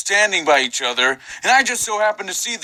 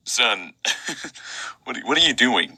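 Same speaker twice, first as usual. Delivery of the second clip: baffled dad voice; 6 semitones lower, 4 dB quieter than usual. Acted.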